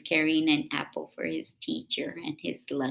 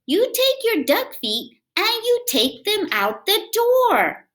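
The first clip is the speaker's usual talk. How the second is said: high, sharp scolding mom voice; scolding mother; 12 dB louder, slower than usual. Acted. Mother scolding her child about the floor, high and stern. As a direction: play the mother telling him off. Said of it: "She would say".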